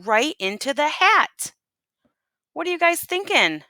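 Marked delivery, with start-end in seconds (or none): none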